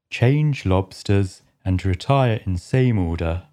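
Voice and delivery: low, gruff creature voice; a rumbling Griffin. Acted. Griffin starts explaining the dance, low and gruff.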